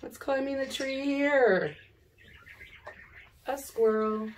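normal talking voice

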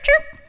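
high, loud chirping cat noise; playful imitation of a chirping cat. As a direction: chirp it out like a cat calling for attention. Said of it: "She'd start chirping".

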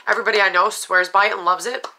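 usual speaking voice

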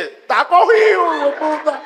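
aguda, lenta y burlona entre risas; búrlate de él con voz de ella; voz de mujer burlona. Retold or imitated. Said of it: "ella voltea y dice". (imitando a la mujer que se burla riendo)

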